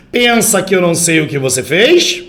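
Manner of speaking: challenging question rising on "fez"; direct question. Shown as a demonstration. Teacher models a direct question, rising interrogative tone. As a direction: end on a clear upward "fez". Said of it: "Olha a entonação".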